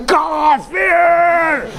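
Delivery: mock rabble-rousing yell, high and drawn out; long, high-pitched rallying cry; hyped-up shout. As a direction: imitate a preacher whipping up the crowd. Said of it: "hyped up and get you hyped up and say".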